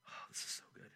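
breathless whispered aside; hushed, amazed whisper; awed whisper. Real, not acted. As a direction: whisper it to yourself in awe. Preacher marvels at the verse.

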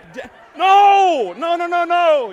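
high, loud frantic no no no; a child begging dad to stop; pleading kid's protest. Retold or imitated. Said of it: "I'm gonna say".

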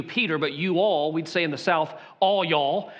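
ordinary talk, nothing marked

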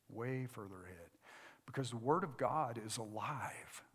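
low, soft preacher's voice with long pauses; hushed preaching. Real, not acted. Preacher urging the congregation toward daily scripture reading, hushed. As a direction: bring the sermon right down, quiet and low.

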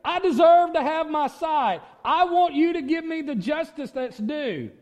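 high, loud mock demand; mock crying out. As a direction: cry out high and loud, as if entitled. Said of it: "you don't cry out and say".

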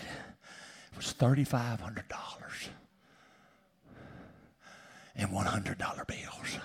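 hushed, slow, breathless whisper; awed whisper. Real, not acted. Preacher revealing the gift to the congregation in an awed whisper. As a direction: whisper it like you can't believe it.

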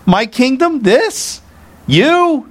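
high-pitched, scornful disbelief; hammed-up haughty indignation; arrogant. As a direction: fire off incredulous questions as if far above them. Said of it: "he could have arrogantly said".

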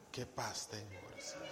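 soft, low sigh of relief; exhale, content after venting; relieved sigh. Retold or imitated. Wife sighing in relief after unloading on her husband. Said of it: "confunde la paz".

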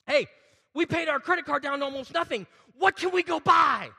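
shouted, gleeful and high, slowing at the end; mock excited shopper. Pastor mocking a churchgoer eager to shop, shouting with glee. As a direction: cheer like you just freed up credit to spend. Mocks spenders after paying card down.

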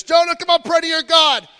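high, loud frantic plea; panicked sailors' plea. Acted. Sailors shouting at Jonah to pray, frantic yell. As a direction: yell it like desperate sailors in a storm.

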